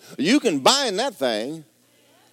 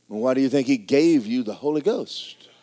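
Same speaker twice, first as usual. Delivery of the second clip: normal talking voice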